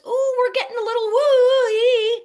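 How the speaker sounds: very high, loud squeal; squealing mock excitement. Coach mocking her own rising energy, hammed-up high squeal. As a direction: squeal it like you're giddy.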